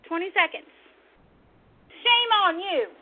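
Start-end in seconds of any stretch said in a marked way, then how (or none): 1.9-2.9 slow, loud scolding; angry rebuke. Real, not acted. Host shaming those who stay silent, angry rebuke. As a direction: draw out the condemnation, loud and high. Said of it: "I'm so angry".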